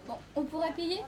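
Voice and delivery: ordinary talk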